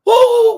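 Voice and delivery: high, loud "woo"; long high-pitched holler; loud whoop. Real, not acted. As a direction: let out a piercing, excited whoop. Whoop before moving on.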